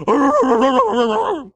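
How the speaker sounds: loud, high rolling dog bark; imitated dog bark. Preacher imitating old yeller to the congregation, loud barking. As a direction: bark like a yard dog going off.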